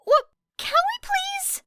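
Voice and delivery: put-on a boy cutting in, caught off guard and spluttering. A narrator's reading. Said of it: "Midoriya spluttered".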